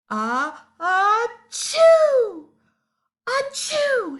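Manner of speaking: loud high hammed-up sneeze; loud comic achoo; big sneeze. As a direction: ham up a huge sneeze. Monkey sneezing.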